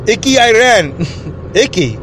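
put-on comic immigrant in a foreign accent; name your country in a mock accent. New arrival from Iran answers greeter, put-on accent. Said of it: "that's some accent you got there".

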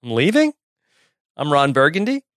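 "leaving" and "Burgundy" both rising like questions. Shown as a demonstration. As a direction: joke it as a puzzled question. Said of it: "said it as a question".

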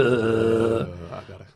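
drawn-out, musing "uh" in mock Southern; mock pondering Southern drawl. Co-host imitates Craig deep in thought, mock Southern drawl. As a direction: ponder slowly like a Southern detective. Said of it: "When he's deep in thought, he goes".

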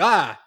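sudden loud startled yelp; mock jump-scare yelp. Startled viewer jolted by a jump scare, loud yelp. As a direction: jump at the scare with a short cry. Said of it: "you're like".

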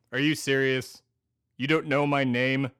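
loud, affronted question; indignant disbelief. Acted. Co-host asks his name, indignant disbelief. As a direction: take offence at being asked.

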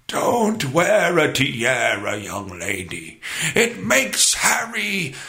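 slow, hammed-up British royal accent; stupid British accent. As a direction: do Prince Charles in a drawn-out British accent. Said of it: "talking in this stupid accent".

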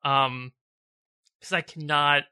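real tears, the voice cracking high on the first words; fighting to get the words out through emotion; voice breaking. Real, not acted. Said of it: "it's making me cry".

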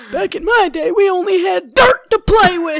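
put-on high, loud, crotchety old man; whiny grandpa shouting. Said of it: "That's my thing".

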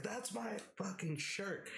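high slow outraged shout; outraged yell. Retold or imitated. Yelling at roommate over his shirt, slow high outrage. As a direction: drag it out in disbelief.

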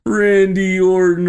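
loud, high, affectionate calling out to a dog; hollering fondly for the dog to come; friend calling his dog. Retold or imitated. Friend calling his Chihuahua over, loud and high. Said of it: "he'd always be like".

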